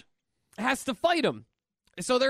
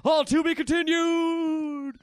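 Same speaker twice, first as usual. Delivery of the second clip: slow, higher, louder [name] drawl; hammed-up [name] farewell; dramatic [name] sign-off; 6 semitones higher, 4 dB louder, slower than usual. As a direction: stretch it out like a cliffhanger. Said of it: "[name] just goes".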